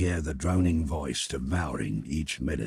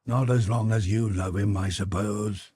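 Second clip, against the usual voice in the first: sullen answer; 5 semitones higher, 4 dB louder than usual. Acted. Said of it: "was the sullen answer".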